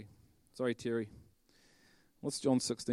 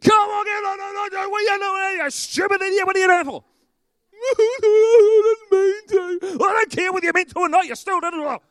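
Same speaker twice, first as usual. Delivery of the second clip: much higher and louder, yelling at the screen; furious shout; 13 dB louder than usual. Acted. Preacher acts out shouting at the football, furious yell. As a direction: blow up at the telly like a furious fan. Acted road rage with football on.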